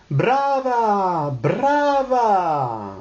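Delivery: high, long rolled-r bravas; crying out. Acted. All the guests applaud the singer, crying out. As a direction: acclaim like an opera audience. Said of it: "s'écriant".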